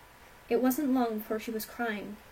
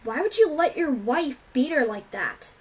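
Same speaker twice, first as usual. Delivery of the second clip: high, loud accusing question; yelling; 4 semitones higher, 8 dB louder than usual. A narrator's reading. Rainbow blaming father for letting wife beat daughter, yelling. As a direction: yell the accusation at full tilt. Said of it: "Rainbow yelled at Cloud Dancer".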